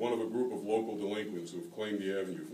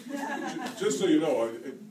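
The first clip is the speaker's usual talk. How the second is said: real laughter spilling into the words, then loud; cracking up while finishing the line; laughing; 7 semitones higher, 6 dB louder than usual. Real, not acted. Reader breaks off the story laughing for the audience.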